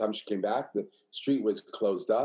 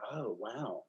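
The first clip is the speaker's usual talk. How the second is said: much softer, retold moment of wonder; quietly awed, in retelling; hushed realization; 11 dB quieter than usual. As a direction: say it under your voice, as if recalling it dawning. Retells a sudden realization in class.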